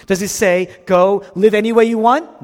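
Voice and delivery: loud, high rhetorical question; incredulous challenge. Acted. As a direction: throw it out as an absurd suggestion. Mock question to the congregation.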